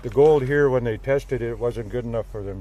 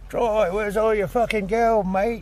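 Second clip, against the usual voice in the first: high, slow put-on English bloke; a hammed-up Englishman asking for the gold, higher and slow; mock English mate; 10 semitones higher, 3 dB louder, slower than usual. Storyteller voicing his English buddy Duncan, mock English accent.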